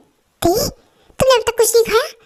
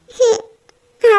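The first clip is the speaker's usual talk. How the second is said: tearful whimper; 5 semitones higher, 4 dB louder, much slower than usual. Acted. Pregnant wife feels unloved, cries.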